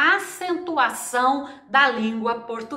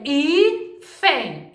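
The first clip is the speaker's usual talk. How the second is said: "hífen" with a long í, then a separate fen; stress on first syllable. Shown as a demonstration. Teacher showing a paroxítona, "hífen" split with weight on the í. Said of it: "I é a sílaba tônica".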